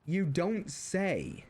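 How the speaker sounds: very slow, dry sarcasm; sarcastic. Retold or imitated. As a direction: drag it out, deadpan. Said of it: "sitting there thinking".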